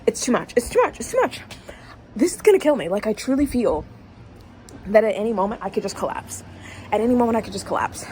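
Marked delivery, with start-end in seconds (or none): 0.1-2.9 climbing higher and louder in mock agony; thrilled reader hammed-up overwhelm; rising overwhelm. Real, not acted. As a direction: let it build to a wail. Overwhelmed by the book.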